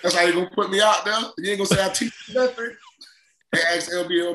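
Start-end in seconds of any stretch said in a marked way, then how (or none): 0.0-2.7 high, quick mock indignation; acted-out offended coach; slighted coach. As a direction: fire off wounded questions, high and fast. Acting slighted coach to player.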